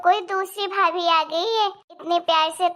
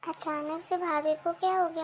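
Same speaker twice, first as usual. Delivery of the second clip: puzzled inner thought; 10 dB quieter than usual. Acted.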